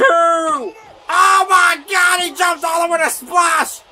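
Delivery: drawn-out, loud excited cry; excited shout. Real, not acted. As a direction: belt it out in disbelief. Reacting to a big splash.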